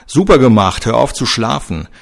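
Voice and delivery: loud, sarcastic jab at a teammate; hissed reproach. A narrator's reading. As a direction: snap at a dozing teammate. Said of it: "zischelte Seeler zu Moritz hinüber".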